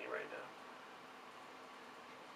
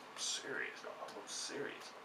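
whispered insistence; 8 semitones higher, 8 dB quieter, slower than usual. Real, not acted.

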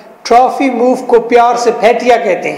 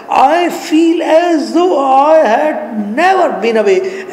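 higher, very slow, drawn-out line; dramatic play reading; 6 semitones higher, much slower than usual. Acted. Anya back home in her own room, dramatic play reading. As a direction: stretch each word in a high, theatrical reading.

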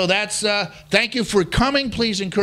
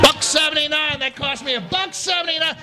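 indignant; 8 semitones higher than usual. Acted. Indignant repeat of price.

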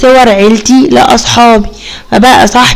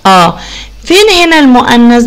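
ordinary delivery

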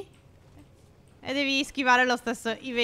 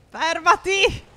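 high desperate shout; screaming while falling; 6 semitones higher, 5 dB louder, much slower than usual. Real, not acted. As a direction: yell "Fermati!" loud and high in panic. Character won't stop sliding.

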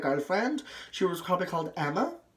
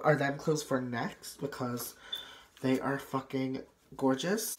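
ordinary delivery

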